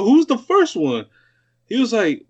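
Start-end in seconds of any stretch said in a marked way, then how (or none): 0.0-1.0 higher and slow, imitating his own confusion; retold puzzled question, pitched up and drawn out; surprised question. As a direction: ask it like you just heard something odd.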